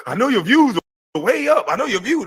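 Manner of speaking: high, mocking imitation of a rival; mocking quote.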